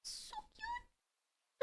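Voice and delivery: very high, soft squeak; tiny cute squeal. Real, not acted. Streamer melting at the kitty, tiny high squeal. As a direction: squeak softly, melting at cuteness.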